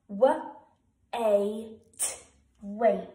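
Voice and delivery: "wait" spelled W, A, T then blended, loud and clear; sounding out. Shown as a demonstration. Teacher sounding out a word for young pupils, clear and loud. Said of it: "Wait is a good word to sound out".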